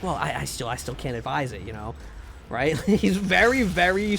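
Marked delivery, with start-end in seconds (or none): 0.0-1.9 lightly voiced GM, softer. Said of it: "he's like".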